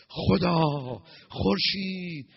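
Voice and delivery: long-held chanting cry, acted out; mock worshipful call. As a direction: call out to the sun god in pretend worship. Acting out worship, calling the sun.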